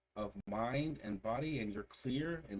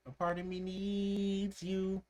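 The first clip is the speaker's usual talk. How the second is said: high, slow, needy drawn-out whine; imitating a needy whine; 11 semitones higher, slower than usual. Reacting to a song, imitating a needy sung line. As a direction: stretch it out like you're begging. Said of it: "It sounds needy and annoying".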